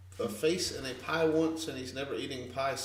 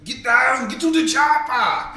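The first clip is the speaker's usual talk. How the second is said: much higher, loud shouted Arnold; Arnold impression; 12 semitones higher, 13 dB louder than usual. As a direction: bellow it like an action hero. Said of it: "I'd like to see y'all's Arnold impressions".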